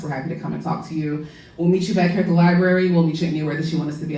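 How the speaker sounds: normal talking voice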